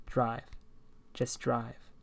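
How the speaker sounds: low, soft, flat order; quiet command. Acted. Stranger in the back seat ordering the driver, low and soft. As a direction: say it low and steady. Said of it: "Once more he said".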